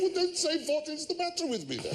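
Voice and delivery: high mock exasperation; teasing the grandad in a high voice; exasperated retort. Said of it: "I said".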